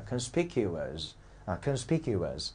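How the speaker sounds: conspicuous spoken slowly with its stress made clear; stress demonstration. Shown as a demonstration. Teacher showing why stress position matters, measured "conspicuous". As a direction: model "conspicuous" slowly, placing the stress with care. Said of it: "叫重音位置很重要".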